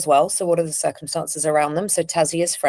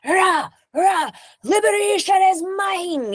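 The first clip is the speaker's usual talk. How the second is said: much higher, shouted battle cry; Carillion screaming; slower than usual. Acted. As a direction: scream it out like a warrior claiming victory. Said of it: "there's a lot of screaming".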